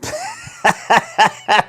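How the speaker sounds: shrill burst of laughter; high squealing laugh. Real, not acted. Laughing at a dance.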